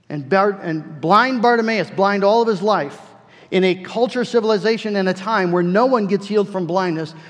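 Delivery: loud, high, driving preacher's cry; impassioned preaching. Acted. Preacher urging his congregation, loud and high. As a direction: raise the roof on the point.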